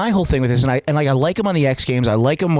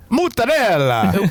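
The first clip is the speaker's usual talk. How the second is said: put-on slow, higher Mozzarella with an Italian accent; Italian accent on one word; 5 semitones higher, slower than usual. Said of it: "if somebody who's not Italian says".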